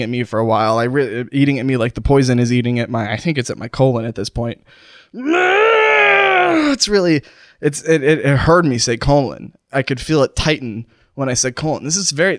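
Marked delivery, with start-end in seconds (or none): 5.1-7.2 long high drawn-out wail of pain; agonized cry. Pretend. Poisoned host reacting to pain in his colon, agonized. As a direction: howl as the poison bites, then force the words out.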